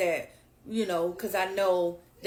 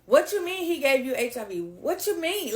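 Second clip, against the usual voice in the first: louder, higher, indignant sassy comeback; imitated sassy retort; 5 semitones higher, 4 dB louder than usual. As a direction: mimic someone sassily challenging the caller. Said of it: "real sassy with it".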